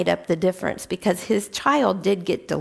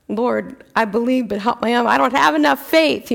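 pleading father; 3 semitones higher, 4 dB louder, faster than usual. Retold or imitated. Mock-voicing the father's plea to Jesus.

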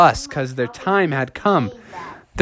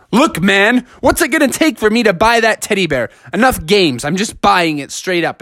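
higher-pitched, exasperated haggling; acted frustration, pitch raised; frustrated; 7 semitones higher than usual. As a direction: plead impatiently, fed up with the game. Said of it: "starting to get really frustrated".

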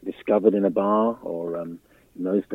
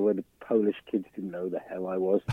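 ordinary delivery

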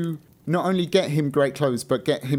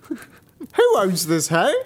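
mock king; 6 semitones higher, 4 dB louder, much slower than usual. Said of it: "why is he asking that as well".

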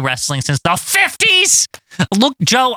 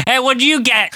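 higher-pitched nosy call; imagined wrestler mid-snack; 9 semitones higher than usual. Pretend. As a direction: call over while eating, playfully acted. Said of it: "he's like".

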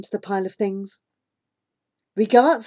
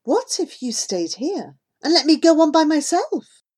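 pretend lightness; 8 semitones higher, 4 dB louder than usual. A narrator's reading. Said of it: "with pretend lightness".

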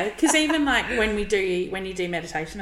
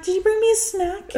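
put-on high, louder little-girl voice asking for a snack; kid voice; 14 semitones higher, 5 dB louder than usual. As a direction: play the eager daughter, pitched way up. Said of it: "She's like".

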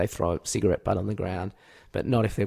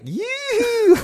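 very high, drawn-out falsetto whoop; awful noise; 4 dB louder than usual. Retold or imitated. Runner recreating his awful taunt, shrieky high whoop. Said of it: "It was an awful noise that I made".

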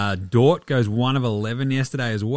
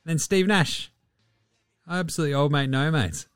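normal talking voice

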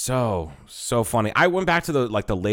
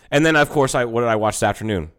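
normal talking voice

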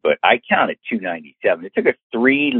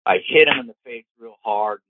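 ordinary delivery, nothing marked

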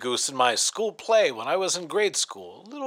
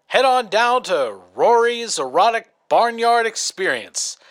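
sponsor ad read; 2 semitones higher, 4 dB louder than usual. Acted. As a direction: sell it like a late-night ad, drawing out the words. Host reads the sponsor ad.

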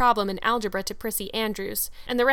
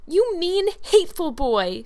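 high, loud indignant cry; passionate outburst; 9 semitones higher, 6 dB louder than usual. A narrator's reading. Girl exclaiming at boy who mocked her braid, passionately. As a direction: fling the insult out with passion, voice leaping up. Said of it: "she exclaimed passionately".

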